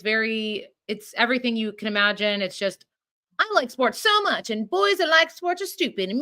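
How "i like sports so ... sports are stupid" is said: higher, sing-song parody of a lovesick heroine; mock Hallmark heroine. Podcaster mocking a Hallmark heroine, high mock voice. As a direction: lampoon a cheesy movie lead, pitched up.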